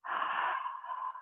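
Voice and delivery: a long breathy exhale through an open throat, as if fogging a mirror. Shown as a demonstration. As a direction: breathe out onto a mirror, throat slightly tight. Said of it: "if you are fogging up a mirror".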